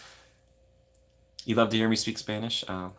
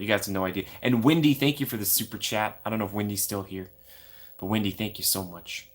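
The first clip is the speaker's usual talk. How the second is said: ordinary talk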